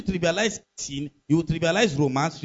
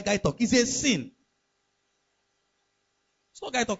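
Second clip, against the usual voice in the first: ordinary talk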